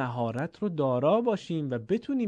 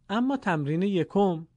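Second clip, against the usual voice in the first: ordinary delivery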